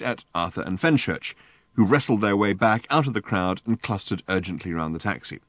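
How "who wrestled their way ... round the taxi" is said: plain reading voice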